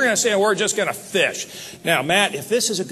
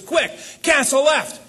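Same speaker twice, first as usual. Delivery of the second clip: urgent order; 8 semitones higher, slower than usual. Retold or imitated. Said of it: "He says".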